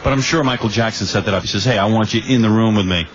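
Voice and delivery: ordinary talk, nothing marked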